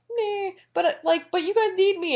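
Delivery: high, whiny mock plea; pleading Lupin. Lupin begging Harry to let him come, high whiny plea. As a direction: beg like a hurt friend.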